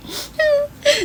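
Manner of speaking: high, cracking laugh-cry; choked-up giggle; teary laugh. Real, not acted. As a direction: laugh through sudden tears. Moved to tears by friends' message.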